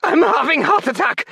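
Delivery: put-on very high, loud German-accented scream; scream in broken English. A narrator's reading. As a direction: scream it in panic. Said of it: "Veeder screamed in broken English".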